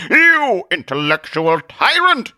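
high, loud, slow heroic accusation; indignant Zealot. Acted. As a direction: denounce a villain with grand outrage. Zealot threatens Atheist.

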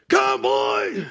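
loud bark of a name; boss yelling. Retold or imitated. Imitating a mean boss calling him, loud yell. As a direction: yell it across the site. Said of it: "He'd always call me Conboy".

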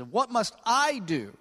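"I" drawn out with a high pitch peak; slow it down and lean on "I"; stress on I. Shown as a demonstration. Preacher shows the lawyer's emphasis, stress on "I". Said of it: "that's what his emphasis is".

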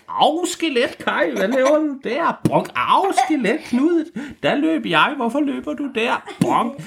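high, silly skeleton squeals with bonks; squeaky skeleton voices. Acted. Skeletons bumping their skulls together, squeaky confused squeals. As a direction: play dim little skeletons colliding.

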